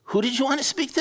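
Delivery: high, puzzled woman on the phone; puzzled receptionist asking who you want, pitched high. Retold or imitated. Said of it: "And she said".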